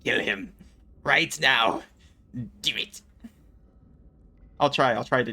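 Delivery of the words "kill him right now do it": a hint of a scheming Sith senator urging; Senator Palpatine voice. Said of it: "Senator Palp voice".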